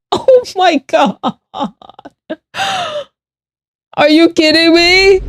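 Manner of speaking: high, gasping disbelief rising to a shout; shocked outrage. Real, not acted. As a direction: let the shock hit, then yell. Shocked by a chat message.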